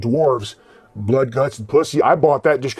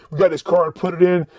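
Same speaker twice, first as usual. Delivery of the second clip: usual speaking voice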